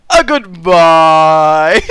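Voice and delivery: loud, drawn-out, very slow goodbye; mock grand farewell; hammed-up farewell. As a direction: stretch the goodbye out loud and dramatic. Acting out last words.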